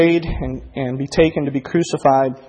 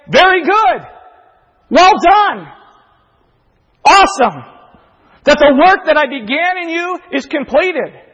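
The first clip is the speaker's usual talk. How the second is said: proud father's praise; 13 semitones higher, 9 dB louder than usual. Acted.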